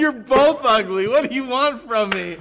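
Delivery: high, loud, laughing "you're both ugly". Real, not acted. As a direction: laugh it out as you tease them. Teasing the twins.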